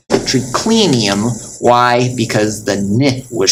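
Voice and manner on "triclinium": "triclinium" slowly, leaning on "cli"; third-to-last syllable stressed. Shown as a demonstration. Teacher repeating the example, stress on "cli". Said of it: "the third to last was clenium".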